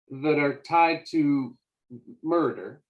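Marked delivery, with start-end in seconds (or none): none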